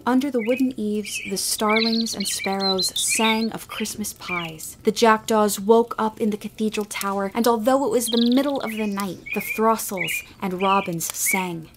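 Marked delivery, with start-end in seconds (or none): none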